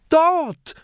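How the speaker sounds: sudden high, loud cry; calling out. Acted. Mathilda calls out spotting the cave, high and loud. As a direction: point and shout it out. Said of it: "ruft Mathilda".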